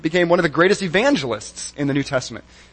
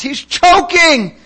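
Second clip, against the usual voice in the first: high, loud, drawn-out cry of alarm; alarmed shout; 13 semitones higher, 8 dB louder, much slower than usual. Retold or imitated. Dinner companion shouting that he's choking, high, loud, drawn out. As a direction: yell it out to the whole restaurant. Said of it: "and she shouts".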